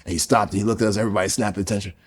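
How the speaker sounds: normal talking voice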